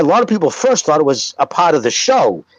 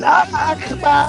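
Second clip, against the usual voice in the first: high, drawn-out mock war cry; mock yell; 11 semitones higher, slower than usual. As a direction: call it out high and stretched, as a joke. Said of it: "Imagine yelling".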